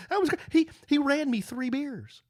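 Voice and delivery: high, delighted proud-father gush. Real, not acted. Father telling wife his son ran him beers, proud high gush. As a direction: gush about your son like it made your day.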